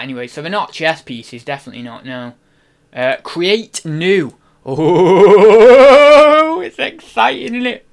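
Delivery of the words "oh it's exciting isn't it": long rising "ooooh" into high-pitched glee; hammed-up giddy anticipation; playful excitement. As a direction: build a loud drawn-out whoop, then stay high. Thrilled at creating a new listing.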